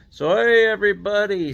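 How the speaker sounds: high, loud mock hello; a hint of the showy vlogger greeting; Mikey Pipes impression. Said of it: "so Mikey Pipes does this".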